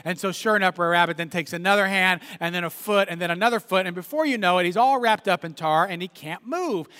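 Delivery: ordinary talk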